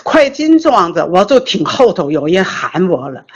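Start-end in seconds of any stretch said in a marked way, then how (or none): none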